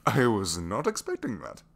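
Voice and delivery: loud, slow amazement; flabbergasted. Acted. Prisoner reacting to an unexpected kiss, flabbergasted. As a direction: stress it loudly, still reeling. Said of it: "I am a tad bit flabbergasted".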